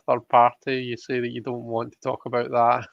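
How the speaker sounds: ordinary delivery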